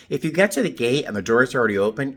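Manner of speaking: ordinary talk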